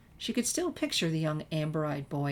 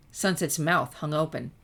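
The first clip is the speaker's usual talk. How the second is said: ordinary delivery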